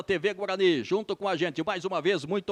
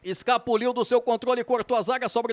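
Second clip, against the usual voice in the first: excited play-by-play; 5 semitones higher, 4 dB louder than usual. Real, not acted. Attack builds, near goal.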